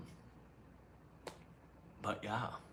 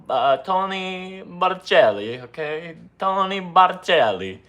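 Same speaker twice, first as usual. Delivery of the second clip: slow, higher, louder Italian name; Italian accent; 6 semitones higher, 5 dB louder, slower than usual. Pretend. Editor Tony announces his full name, Italian accent. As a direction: roll out the Italian name proudly. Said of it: "Tony what?".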